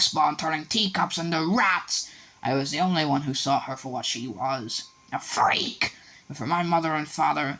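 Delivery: high, bitter, shrill-voiced aunt; shrieking rant. A narrator's reading. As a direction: spit out years of jealousy. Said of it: "shrieked Aunt Petunia suddenly".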